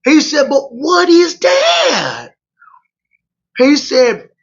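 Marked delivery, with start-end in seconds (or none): 0.5-2.3 baffled. Retold or imitated. Preacher baffled by strange food.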